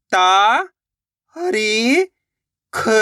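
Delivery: ता, हरी, ख, each standing alone; syllable-by-syllable sounding out. Shown as a demonstration. Teacher spells out a word for learners, ता, हरी, ख singly. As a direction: draw out ता and हरी, then a separate ख. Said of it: "एक एक अक्षर का उच्चारण करके".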